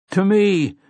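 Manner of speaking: high rallying cry; cries. Acted. Roland calls his men to him with a high cry. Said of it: "cries Roland of Gilead".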